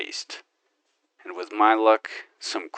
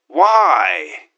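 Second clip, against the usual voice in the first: loud, high, drawn-out why; indignant shout; exasperated cry; 7 semitones higher, 10 dB louder than usual. Acted. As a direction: throw the question at the sky. Outraged question.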